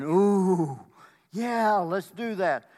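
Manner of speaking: loud, high, drawn-out wowed agreement; mock crowd excitement. Everybody agreeing to the image, loud mock excitement. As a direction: stretch it out like an impressed crowd.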